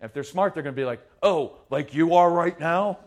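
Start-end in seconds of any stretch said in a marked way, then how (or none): 1.2-3.0 loud, slow, mocking kid's retort; sarcastic comeback. Smart kid talking back to a yelling parent, sarcastic. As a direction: throw it back pointedly with a rising question.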